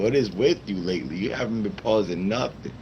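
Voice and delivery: normal talking voice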